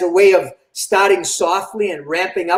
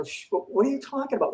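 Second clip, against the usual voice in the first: higher, softer aside, shushing; hushed reply; 4 semitones higher, 10 dB quieter, faster than usual. Retold or imitated. Polite hushing during someone's singing.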